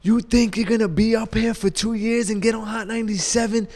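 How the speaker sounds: high-pitched mock rant, no real volume; imitated screaming. Radio veteran screaming at the new guy, imitated. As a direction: imitate a boss barking at a newcomer. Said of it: "he just starts screaming on me".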